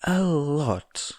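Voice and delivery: put-on a small child trying to sound menacing; mock menacing child. A narrator's reading. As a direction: Eri threatening the investigators, slow. Eri continuing her threat.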